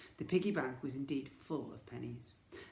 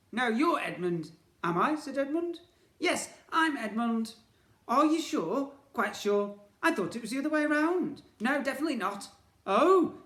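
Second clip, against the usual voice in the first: high, loud befuddled twin voice; muddled villain twin; 11 semitones higher, 11 dB louder than usual. Acted. Twins confusing each other's names, high loud muddled bickering.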